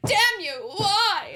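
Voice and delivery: high-pitched, hammed-up anguished demand; mock emotional outburst. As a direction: imitate a full-body dramatic outburst. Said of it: "emotional outbursts".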